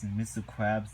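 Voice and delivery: usual speaking voice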